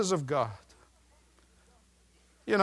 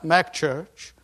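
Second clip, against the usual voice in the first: ordinary talk, nothing marked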